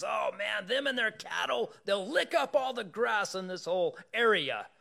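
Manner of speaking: a king panicking at the crowd; voicing the worried king. King fretting to Balaam about Israelites' cattle, high and loud. King retold in Bible story.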